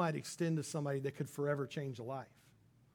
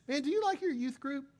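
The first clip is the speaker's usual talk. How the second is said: higher, lightly voiced classmate; buddy asking; 8 semitones higher than usual. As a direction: play a casual school buddy. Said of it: "a buddy says".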